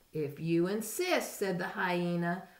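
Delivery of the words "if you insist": usual speaking voice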